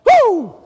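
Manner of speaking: loud, high, full-out "woo"; excited whoop. Retold or imitated. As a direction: whoop with all your excitement. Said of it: "I was still going with at it".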